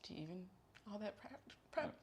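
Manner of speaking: quietly asking if they even cared; incredulous mock question. Mock interviewer who ignored her prep, incredulous.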